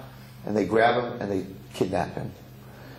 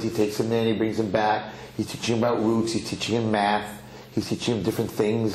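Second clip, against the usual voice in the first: usual speaking voice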